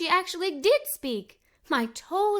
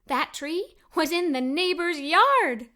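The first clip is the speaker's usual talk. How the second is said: ordinary delivery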